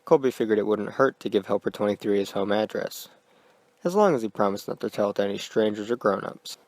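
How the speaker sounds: plain reading voice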